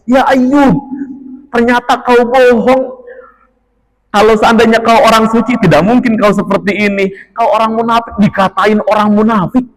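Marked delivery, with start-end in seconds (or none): 0.1-8.1 high, accusing call toward a distant house; calling from afar. Retold or imitated. Relatives jeer at Ayub from afar, calling out high. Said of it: "manggil Nabi Ayub tuh di depan rumah dan jauh jaraknya".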